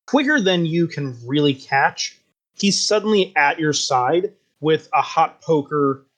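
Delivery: ordinary delivery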